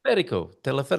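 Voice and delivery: "Teleférico" with the accent on the "fé"; stress on FÉ. Shown as a demonstration. Co-host echoing the guest's Portuguese word, stress on FÉ. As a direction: say "Teleférico" twice, leaning on the accented E. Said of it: "with an accent on the E".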